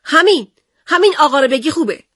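high, loud sulky answer; frowning retort. Acted. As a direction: snap it back with a frown. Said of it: "با اخم گفت".

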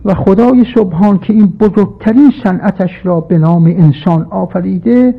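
usual speaking voice

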